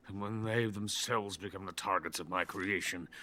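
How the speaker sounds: ordinary delivery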